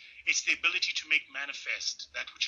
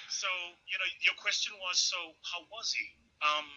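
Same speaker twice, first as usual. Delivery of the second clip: normal talking voice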